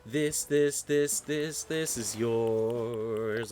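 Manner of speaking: slow insistent offer. Acted. Giving gift insistently.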